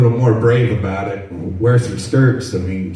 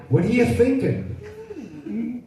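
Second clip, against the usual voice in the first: mock scolding; 14 semitones higher, 6 dB quieter, much slower than usual. Mock parent scolding the kid.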